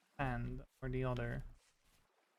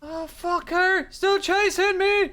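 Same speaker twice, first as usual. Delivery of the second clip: high, loud, drawn-out scream; panicked yell; 14 dB louder, slower than usual. Real, not acted. Player still being chased in game, panicked yell. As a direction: yell it while fleeing a pursuer.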